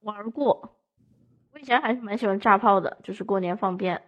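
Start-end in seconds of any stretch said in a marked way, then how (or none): none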